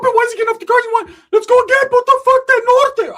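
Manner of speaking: very high, loud furious scream; Ricky screaming. Retold or imitated. Ricky wants to fight the rival again, high loud scream. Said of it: "Ricky's like".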